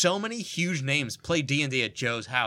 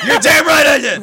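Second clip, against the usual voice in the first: mock tough guy; 11 semitones higher, 12 dB louder than usual.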